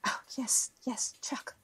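hushed whisper, correcting herself; sheepish whisper of the right name. Acted. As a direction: whisper it, a little embarrassed.

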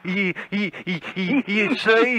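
stammering squeaks on "you"; squeaky stutter. Acted. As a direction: stutter helplessly, squeaking the word out. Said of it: "squeak like a monkey".

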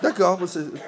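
ordinary talk, nothing marked

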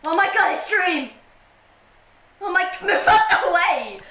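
high, loud starstruck shout; mock fan excitement. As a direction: yell like a fan shoving through, laughing. Repeats pretend fan gushing at Dream.

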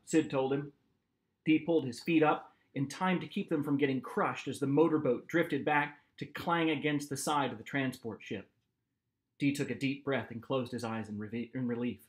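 usual speaking voice

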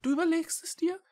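very high small cat voice; hopeful little cat. A narrator's reading. (hoffnungsvolle, hohe Katerstimme)

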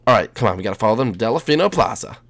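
ordinary delivery

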